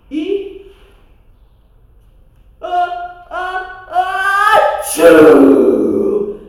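building sneeze, loud explosive tchum; big sneeze. Acted. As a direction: build up then sneeze hugely. Dragon sneezing. (espirro enorme)

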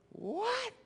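a high, jumping New York What; New York accent. Retold or imitated. Pastor joking the king is from New York, New York What. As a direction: toss the What like a New Yorker. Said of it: "He's probably from New York".

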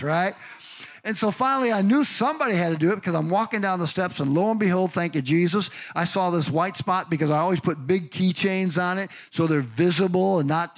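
ordinary delivery

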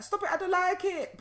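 put-on high, loud child's protest; Sana. Mother imitating daughter protesting, high child voice. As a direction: mimic a little girl's squeaky complaint, amused. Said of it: "she's like".

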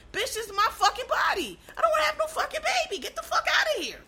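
a hammed-up woman laughing off the idea; mock angry retort.

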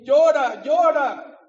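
loud, pleading "Llora, llora" with high pitch; urging to cry. Retold or imitated. Superviviente le ruega a la madre: ¡llora, llora!, alto y agudo. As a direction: survivor urging the grieving mother: cry out. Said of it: "Y le dijo, mira".

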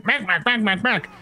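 high, rapid kobold yapping; mock kobold shouting. Game master voicing confused kobolds, mock shouting. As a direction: yap it high, without real shouting volume. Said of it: "they start shouting".

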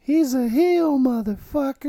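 high, loud hammed-up heckle; mock heckling fan. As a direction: jeer like a rowdy fan. Mocking heel heckles.

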